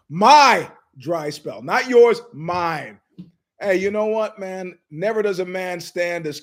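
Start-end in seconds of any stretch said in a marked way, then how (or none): 0.0-1.6 laughing. Real, not acted.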